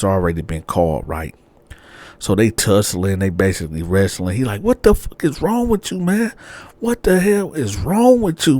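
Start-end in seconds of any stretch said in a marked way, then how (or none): 4.6-8.6 high, raging at her; fighting over the phone; high furious yell. Retold or imitated. Boyfriend screams at girlfriend while wrestling for the phone.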